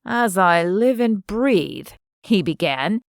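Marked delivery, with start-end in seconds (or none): 0.1-1.9 slow, hammed-up Southern drawl; an Englishman butchering a South Carolina accent; Mister Cecil's drawl. A narrator's reading. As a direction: put on a terrible Southern gentleman's drawl. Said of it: "in his best imitation of Mister Cecil's drawl".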